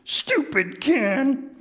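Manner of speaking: high, slow pouty huff; grumpy Grover. Acted. Grover kicking a can, slow high pout. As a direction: kick it and grumble. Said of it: "letting me do the voices".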